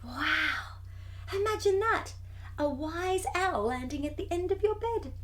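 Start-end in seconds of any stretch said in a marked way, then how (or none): none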